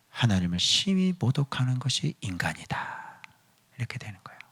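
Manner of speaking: whispered sermon aside; hushed whisper. Acted. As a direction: draw them in with a breathy hush. (속삭이는 설교)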